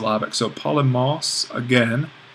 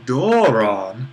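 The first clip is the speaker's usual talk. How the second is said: DORON stretched slowly, pitch rising, dipping and lifting at the end; 4 dB louder than usual. Shown as a demonstration. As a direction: glide up and down through "doron", then up again. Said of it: "go up and down and then up again".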